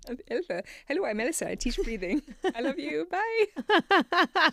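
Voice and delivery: high, loud hammed-up Swedish accent climbing to a squeal; playful Swedish impression rising to a high bye. Imitating coworker Elsa introducing herself, hammed-up Swedish accent. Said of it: "trying to do the Swedish accent".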